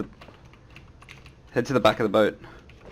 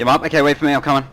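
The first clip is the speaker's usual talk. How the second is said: raised urgent call; 5 semitones higher, 6 dB louder, faster than usual. Real, not acted. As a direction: call across to the squad. Calling out mid-fight.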